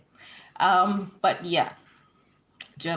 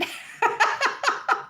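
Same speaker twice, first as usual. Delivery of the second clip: an airy laugh, pitched way up; breathy high laugh. Real, not acted. Host laughing at a retracted chat message. Message vanished while reading.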